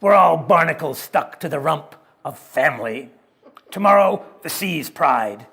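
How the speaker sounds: loud, grinning pirate-like sailor; gruff sailor. Acted. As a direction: bellow it like a grinning old sailor. Sailor speaks in story.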